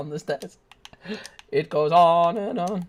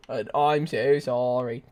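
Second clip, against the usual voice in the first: ordinary talk, nothing marked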